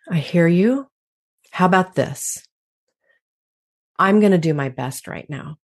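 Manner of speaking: normal talking voice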